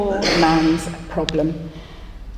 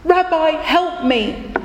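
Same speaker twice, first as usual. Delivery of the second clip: high, slowed plea for help; poor man begging the rabbi for help, high and pleading; 6 semitones higher than usual. Acted. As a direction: beg the rabbi, near despair. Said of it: "he said".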